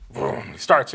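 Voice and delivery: usual speaking voice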